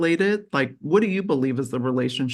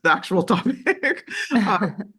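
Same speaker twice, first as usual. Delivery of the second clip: laughing; 6 semitones higher, 4 dB louder than usual. Real, not acted. Host laughing, back to topic.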